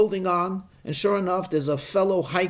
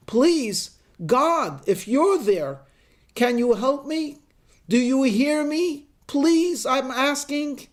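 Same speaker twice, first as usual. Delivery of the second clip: desperate plea; 8 semitones higher, slower than usual. Acted. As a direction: beg heaven for help at the top of your voice. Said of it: "he shouts".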